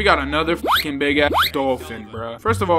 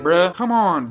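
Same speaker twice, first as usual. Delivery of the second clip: ordinary delivery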